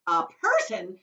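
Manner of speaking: loud, astonished cry; cried out. Acted. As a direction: blurt it in disbelief. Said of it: "cried Mister Wonka".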